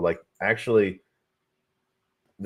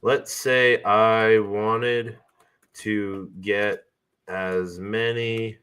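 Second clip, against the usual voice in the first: slow, deliberate dictating voice; slow dictation; much slower than usual. Acted. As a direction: spell it out slowly for the mic. Dictating a prompt to assistant.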